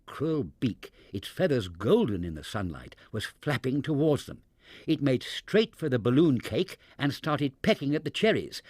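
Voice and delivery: ordinary delivery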